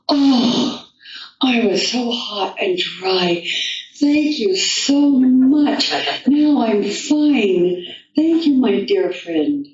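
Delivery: high, slow, breathless thanks from a frog back from the dead; high and slow grateful frog voice; grateful revived Frog. Acted. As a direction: sigh out relief and gratitude, drawn out.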